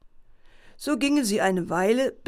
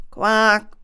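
a loud mock frog croak; 7 dB louder than usual. Frogs at the pond call out with a loud croak. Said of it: "riefen die Frösche".

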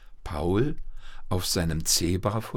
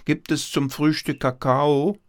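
raised, higher-pitched called question; calling out; 7 semitones higher, 5 dB louder than usual. A narrator's reading. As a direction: call hopefully to the kitchen. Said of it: "rief Paul". (laut fragend in die Küche rufen)

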